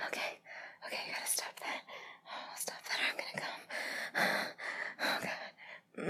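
soft, voiceless gasping whisper; breathless whispered plea. Acted. Lover begging a partner to pause, breathless whisper. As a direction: plead under your breath, barely holding on.

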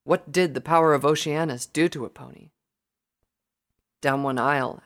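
plain reading voice

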